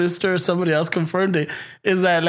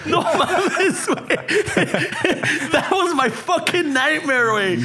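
laughing; 6 semitones higher, slower than usual. Real, not acted. Cracking up at own story.

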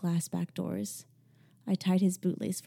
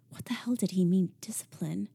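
ordinary delivery

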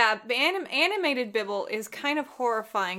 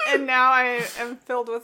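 laughing; 3 semitones higher, 6 dB louder than usual. Real, not acted. Laughing at own joke.

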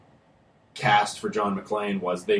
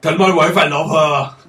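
high, loud last words of a dying hero; wounded soldier gasping; 6 semitones higher, 8 dB louder than usual. Retold or imitated.

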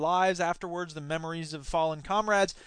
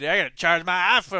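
ordinary talk, nothing marked

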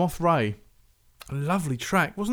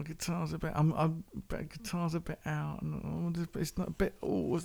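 soft, hesitant muttering; doubtful inner thought; 3 semitones higher, 10 dB quieter than usual. Retold or imitated. Host voicing his first doubts about a track, doubtful muttering. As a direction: mumble private doubts, higher and softer.

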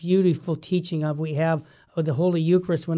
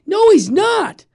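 loud, slow, much higher "No, he's not."; emphatic denial; 12 semitones higher, 8 dB louder, slower than usual. Real, not acted. Host rejecting lyrics about Jesus, a loud slow protest. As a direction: slam the door on the idea. Rejecting false teaching in songs.

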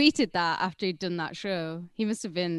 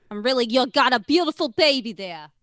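louder, put-on New York accent; 5 semitones higher, 6 dB louder than usual. Shown as a demonstration. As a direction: lay on a New York accent, pushed up loud. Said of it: "I can do New York".

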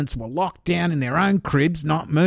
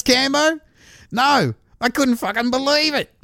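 usual speaking voice

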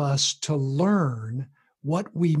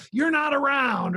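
higher, fast, shouted blame; kid's angry retort; 9 semitones higher than usual. Retold or imitated. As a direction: hurl it back at the parent. Kid blaming parent back.

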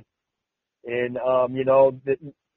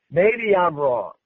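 mock humble; 3 semitones higher, slower than usual. Sarcastic mock concession.

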